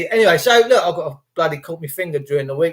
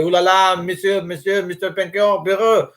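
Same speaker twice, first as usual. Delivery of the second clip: mock French accent; 2 semitones higher than usual. Joking about French coastguards, in a mock French accent. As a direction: toss off a cartoon French accent. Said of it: "And the French go".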